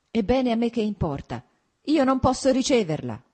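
high, indignant outburst; crying out. A narrator's reading. Wife refusing to receive Anna, crying out angrily. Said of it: "gridò lei".

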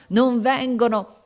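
"non vengono" high, loud and slow; desperate cry. A narrator's reading. Captain losing hope of help, "non vengono" cried desperately. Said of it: "gridava con voce smozzicata".

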